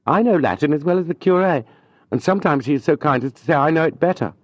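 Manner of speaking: put-on a touch of wounded pride in the young man; young tutor, proud. A narrator's reading. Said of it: "Julien answers proudly".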